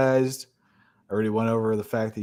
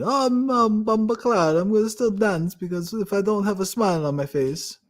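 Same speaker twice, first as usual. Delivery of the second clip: hammed-up Jamaican accent, much higher and louder; a clumsy mock Jamaican accent, pitched way up; bad Jamaican accent; 10 semitones higher, 4 dB louder than usual. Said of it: "That was the worst Jamaican accent ever".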